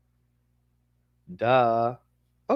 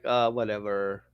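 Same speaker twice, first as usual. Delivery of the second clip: bored dog; 8 semitones lower, 4 dB quieter than usual. Retold or imitated. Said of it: "And the dog is like".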